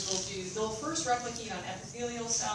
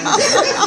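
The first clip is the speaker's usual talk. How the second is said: high, loud helpless laugh; loud burst of laughter; 19 dB louder than usual. Real, not acted. Instructor bursting out laughing at the diamond joke. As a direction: let out a big laugh.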